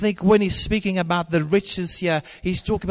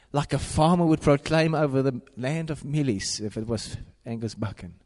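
ordinary delivery

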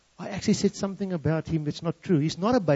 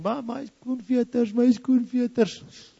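high, very slow mock wail; imitated crying; 8 semitones higher, much slower than usual. Son in the car crying over shoelaces, high slow mock sobbing wail. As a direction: drag it out like a crying toddler. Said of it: "he's crying".

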